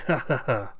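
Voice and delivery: short string of gloating ha-has; villain's acted laugh. Cyborg recalls old fool's face, gloating laugh. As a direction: laugh smugly, in character.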